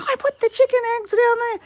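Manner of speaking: high, loud holler; re-enacted yelling. Shouting downstairs about where eggs are, high holler. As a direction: call it out to someone on another floor. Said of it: "I'm yelling this out from upstairs".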